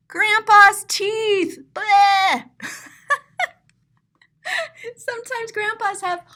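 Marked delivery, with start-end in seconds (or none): 0.1-1.6 loud, slow, high cry of mock horror; shocked outburst. Real, not acted. Gasps at grandpa's teeth hung up. 1.7-2.4 loud, high mock-disgusted bleh. Real, not acted. Disgust at the hung-up teeth.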